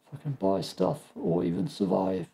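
thin reedy voice, going weak; weak, strained voice. Real, not acted. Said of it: "my voice is super weak today".

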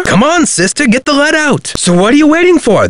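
high, pushy hurry-up; impatient urging. Acted. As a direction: egg her on, pitch up. Activist urges Nancy to hurry.

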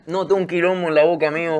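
panicked mouthful complaint; mouth full. Real, not acted. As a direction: blurt it out with food in your mouth. Mouth full of awful food.

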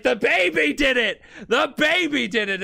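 high, loud mock hysteria; frantic accusing shout. Kakuin waking as the plane crashes, frantic accusing shout. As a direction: cry it out like nobody will believe you.

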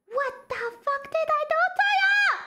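high, fast wail of protest; exasperated outcry. Real, not acted. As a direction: protest in a high, aggrieved cry. Repeats complaint in high outcry.

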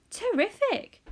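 high, loud delighted cry; excited cry. A narrator's reading. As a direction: exclaim in pure delight. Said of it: "cried Grandpa Joe".

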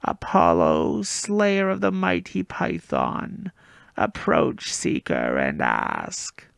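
slow, eerie serpent-like spirit speaking from everywhere; slithering oracle voice. Acted. Ancient spirit to the boy, slow slithering voice. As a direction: let the words coil and slither out slowly. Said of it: "a voice slithering into one ear and coiling around my brain".

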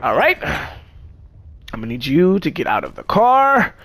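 very slow, stern traffic-cop order; mock cop. Pretending to be a cop pulling a driver out, slow. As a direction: drag out each word like a bored cop. Playing a cop at a car stop.

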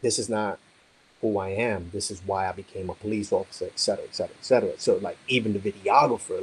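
ordinary talk

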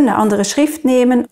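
normal talking voice

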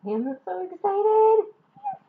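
high and loud, slow joyful cry; excited shout. Real, not acted. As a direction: belt out the excitement. Unboxing her Army Bomb.

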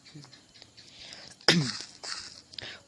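breathy, whispered sick cough. Real, not acted. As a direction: cough hard to clear a sore throat. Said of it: "รู้สึกว่าเสียงนี่ไม่ค่อยมีเลยนะ".

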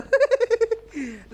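loud, high cackling laugh; shrieky burst of laughter; high-pitched giggling. Real, not acted. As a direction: giggle loudly at the fight. Laughs while urging a fight.

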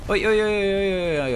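loud, high repeated oi calls; shouting oi. Real, not acted. Gamer yelling at an enemy tank, loud high calls.

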